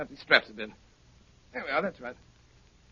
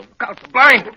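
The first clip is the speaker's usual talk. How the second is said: sudden loud high warning; alarmed warning cry; urgent shout; 10 semitones higher, 15 dB louder than usual. Acted.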